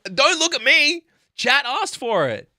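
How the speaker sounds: defensive outburst. Pretend. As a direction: squeal it out in mock defence. Defends himself over terrible story.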